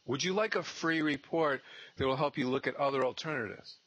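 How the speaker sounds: high, sweet greeting, slowing at the end; bright door-knock pitch. Shown as a demonstration. Intern pitching a listed seller at the door, high and sweet. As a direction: sell the plan B politely with a smile. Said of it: "I go".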